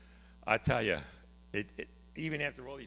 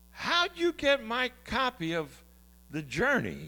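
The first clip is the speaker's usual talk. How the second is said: astonished; 12 semitones higher, 6 dB louder, slower than usual. Retold or imitated. Jim astonished on the phone.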